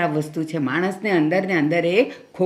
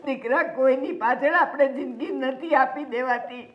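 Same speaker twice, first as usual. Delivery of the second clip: crying consolation; 6 semitones higher than usual. Acted. Mother consoling while crying.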